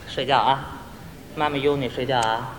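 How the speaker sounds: very soft, low coaxing; imitated mother murmuring at bedtime; young mother. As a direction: hush a baby to sleep. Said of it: "我就是那年轻的妈妈".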